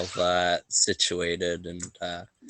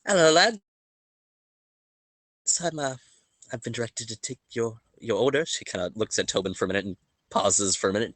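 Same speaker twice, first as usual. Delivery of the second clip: put-on higher, hesitant young waitress voice; flustered barmaid stammering through the order; shy waitress; 4 semitones higher, faster than usual. Waitress nervously taking the adventurers' breakfast order. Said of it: "grabs a quill and".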